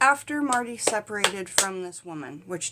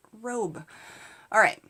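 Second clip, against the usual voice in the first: ordinary talk, nothing marked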